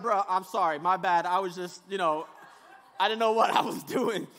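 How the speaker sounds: put-on the storm backing down like a scolded kid; sheepish storm. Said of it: "the storm is like".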